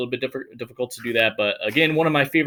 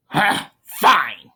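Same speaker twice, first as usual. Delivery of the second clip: a touch of mock huff, louder on fine; embarrassed huff; 6 semitones higher, 6 dB louder than usual. Wilfred giving up in a mildly hammed huff. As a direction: storm off with a light huff. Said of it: "Embarrassed and enraged".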